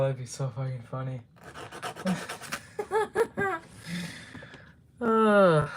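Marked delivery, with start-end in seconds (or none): 1.5-4.6 silent wheeze of laughter; breathy wheezing laugh. Real, not acted.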